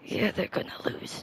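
soft unvoiced whisper. Real, not acted. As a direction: whisper it aside. Whispering a taunt.

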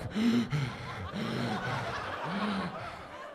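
long, heavy, laboured breaths; stuffed-full gasping. Acted. Diner stuffed with takeout, heavy overfed gasping. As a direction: pant and groan as if overfed. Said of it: "I'm full like".

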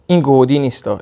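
usual speaking voice

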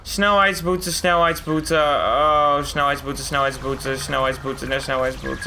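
low repetitive muttering; deep, weary grumble down a list; dismayed car owner. Acted. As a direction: read each one with sinking dismay. Owner reads out pile of fines.

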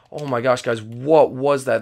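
usual speaking voice

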